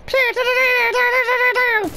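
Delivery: high rapid do-do-do gunfire; mock machine-gun noise. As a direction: rattle it off like a toy machine gun. Imitating machine gun fire.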